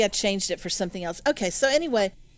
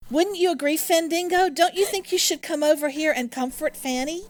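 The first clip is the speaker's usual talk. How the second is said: coaxing; 6 semitones higher than usual. Acted. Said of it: "turn her around toward Fandango and say".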